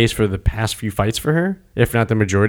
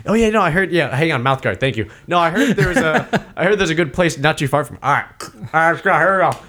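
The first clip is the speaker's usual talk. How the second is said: casual chit-chat; 6 semitones higher than usual. Retold or imitated. Relaxed fighter chatting in corner.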